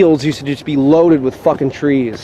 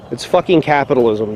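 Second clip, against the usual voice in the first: ordinary talk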